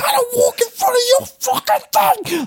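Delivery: very high, strained mock screaming rant. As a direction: imitate a furious on-set freak-out. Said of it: "screaming at him".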